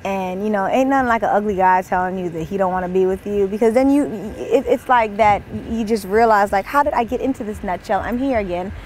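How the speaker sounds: normal talking voice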